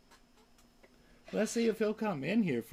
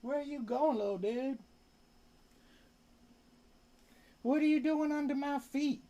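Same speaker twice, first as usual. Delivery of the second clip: high pet talk; 7 semitones higher than usual. Real, not acted. Talking to the pet.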